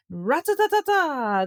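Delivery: high, rapid-syllable "rat-ta-ta-ta-ta"; drumroll imitation. A narrator's reading. Tom builds up to the worm eating, high vocal drumroll. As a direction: roll it out like a fanfare drum.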